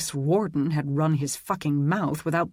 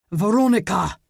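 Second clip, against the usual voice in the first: furious roar, much louder and higher; 5 semitones higher, 6 dB louder than usual. A narrator's reading. Roland roaring at Aidan in rage, furious roar. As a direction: bellow the name across the lot. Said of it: "Roland roared".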